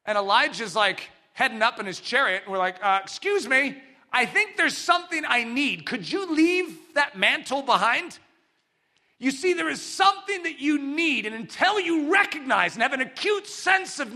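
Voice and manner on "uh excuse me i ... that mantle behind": raised, higher-pitched request; acted pleading interruption; polite calling after. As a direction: call after someone who is leaving. Calls after departing Elijah.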